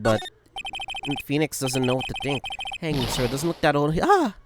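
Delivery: usual speaking voice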